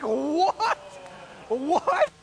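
high, loud shriek of disbelief, played for laughs; incredulous. Boyfriend learning the breakup was a joke, incredulous. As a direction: blurt it out high and loud, flabbergasted.

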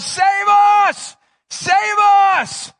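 loud, high cry of save us; crowd shout. Retold or imitated. The crowd shouting before his death, loud cry. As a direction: call out like a pleading crowd. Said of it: "They were shouting".

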